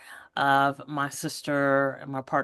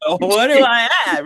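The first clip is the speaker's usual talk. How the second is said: confused; 5 semitones higher, 8 dB louder than usual. Retold or imitated. Baffled reply to cheerful doctor.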